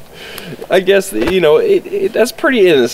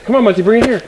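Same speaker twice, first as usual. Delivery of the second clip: calling the dog; 8 semitones higher, much faster than usual. Acted.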